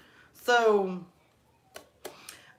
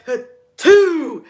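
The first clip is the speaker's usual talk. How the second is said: big loud explosive sneeze, raised pitch; reenacted sneeze; 5 semitones higher, 11 dB louder than usual. Restocking downstairs near the boss, reenacted booming sneeze. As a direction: let a mock sneeze blast out. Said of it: "I'm like".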